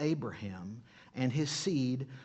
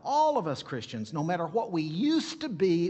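louder, rising preacher fervour; preaching; 4 semitones higher, 4 dB louder than usual. Real, not acted. Pastor stirring his congregation, preaching with rising fervour. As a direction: build into full preaching. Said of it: "ooh, I'm preaching now".

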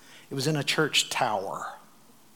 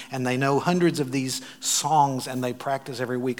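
ordinary talk

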